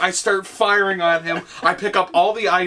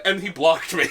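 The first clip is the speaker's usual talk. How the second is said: ordinary talk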